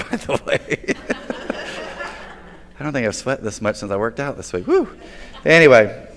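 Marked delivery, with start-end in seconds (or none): 0.1-1.1 real chuckle through the words; laughing aside. Real, not acted. Preacher admits he's hot up here, laughing aside. 4.7-4.9 short, high whoop of exertion; relieved "woo". Real, not acted. Preacher sweating up here, relieved whoop. Exclaims about sweating onstage.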